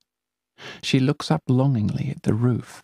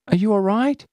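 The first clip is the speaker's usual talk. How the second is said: high anxious call; worried girl; 11 semitones higher, 4 dB louder, faster than usual. Acted. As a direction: call down into the drain. Companion calling down.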